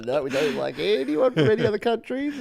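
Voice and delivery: high, put-on voice mocking the host. Guest teasing the host about excluding countries, mock voice. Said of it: "You're like, uh".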